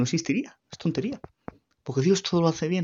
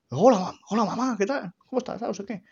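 cheerful greeting; 9 semitones higher than usual. Retold or imitated. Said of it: "todo contento".

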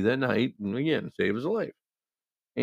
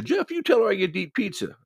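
going off on her; 11 semitones higher than usual. Retold or imitated. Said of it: "starts going off on her again".

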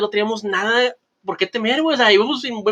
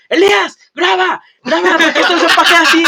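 imitated shouting; 10 semitones higher, 6 dB louder than usual. Handcuffed friend yells to Elías to film the police, shouting. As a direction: mimic a friend yelling out as he's taken away. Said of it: "Michelle estaba gritando".

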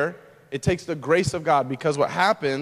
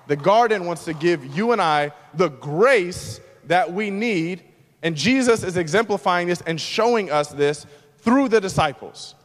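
ordinary talk

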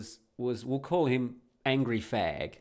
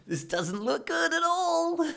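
much higher, slow, cracking with laughter; laughing through words; 4 dB louder, slower than usual. Retold or imitated. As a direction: squeak it out while laughing helplessly. Nervous joke to wife on plane.

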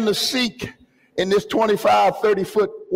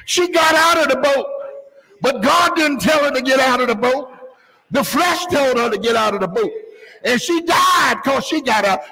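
raised, driving pulpit delivery; fervent preaching; 5 semitones higher than usual. Real, not acted. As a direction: drive the warning home to the congregation. Preacher at full force.

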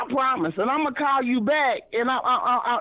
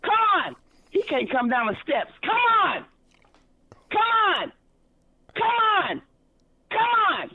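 calling out; 8 semitones higher than usual. Real, not acted. Calling someone down the steps.

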